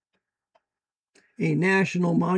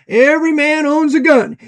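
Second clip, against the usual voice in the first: raised and louder, hammering the demand; forceful declaration; 7 semitones higher, 6 dB louder than usual. Real, not acted. Preacher to his listeners, demanding every man own a gun, loud and forceful. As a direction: proclaim it like a law.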